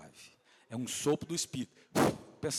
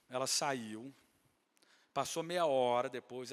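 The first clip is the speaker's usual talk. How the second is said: ordinary delivery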